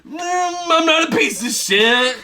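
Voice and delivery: cartoonish child impression; mock Kevin voice. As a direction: squeal it like a bratty kid. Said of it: "And he goes".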